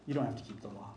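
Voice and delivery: quiet quoted claim. Retold or imitated. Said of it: "antinomianism says".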